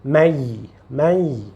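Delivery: mei ending in a nasal y glide; n turned to y. Shown as a demonstration. Teacher models the merged sound, mei with a y glide. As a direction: let mei close on a y, with no n. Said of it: "você vai dizer".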